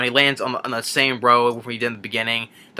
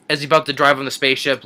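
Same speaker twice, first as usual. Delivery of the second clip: ordinary delivery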